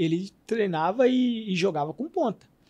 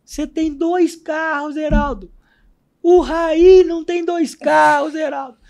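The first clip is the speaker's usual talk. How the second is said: imitated coach's scolding; 7 semitones higher, 7 dB louder than usual. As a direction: shout it across at a player like an outraged coach. Coach scolding player in retold story. (bronca de técnico imitada, alta e aguda)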